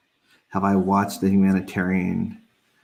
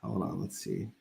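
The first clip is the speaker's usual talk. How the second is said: ordinary talk, nothing marked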